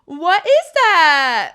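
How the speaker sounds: high, slow, drawn-out squeal; [name] impression. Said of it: "my [name] impression".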